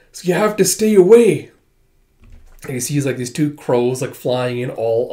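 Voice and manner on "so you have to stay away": louder, higher plea to stay away; urgent warning. Acted. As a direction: push him away, urgently. Warning friend of danger.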